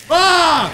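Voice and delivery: loud, high yelp of alarm; startled scream. Real, not acted. Player suddenly frozen in a block of ice, startled scream. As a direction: cry out as if suddenly hit. Suddenly hit while playing.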